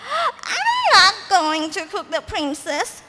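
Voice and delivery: high, slow squeal of a witch. Acted. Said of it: "squealed the witch".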